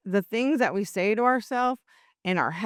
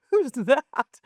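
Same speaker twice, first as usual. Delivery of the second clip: higher, startled double-take; mock-baffled question, pitched up; retold surprise; 7 semitones higher, 4 dB louder than usual. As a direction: act out not knowing your own reflection. Retold surprise at own reflection.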